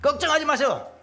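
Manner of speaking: high, projected announcement to a crowd; acted disciple calling to the multitude; addressing a crowd. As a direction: reassure a big crowd with a lifted voice. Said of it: "많은 무리를 향하여".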